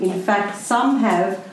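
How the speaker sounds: ordinary delivery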